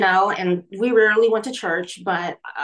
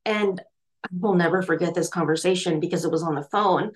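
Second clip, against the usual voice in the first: normal talking voice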